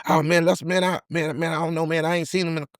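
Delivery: high, soft and evasive; nervous mumble. Retold or imitated. Dude nervously dodging.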